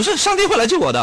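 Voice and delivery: high, stubborn believer's voice; hammed-up devout man; mock pious faith. Man refuses the boat.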